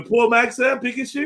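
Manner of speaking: high-pitched street vendor pitch; mock magazine seller. Selling the poverty magazine to a passer-by, high vendor voice.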